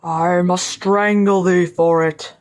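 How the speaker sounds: slow, menacing giant spirit; awful spirit voice. A narrator's reading. Freed spirit towers over the scholar, awful voice. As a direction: draw it out in a dreadful monster voice. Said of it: "cried the spirit".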